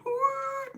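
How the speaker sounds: high hooted train whistle. Acted. Mimicking a whistle on a train, high hoot. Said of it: "like, a whistle on a, on a train".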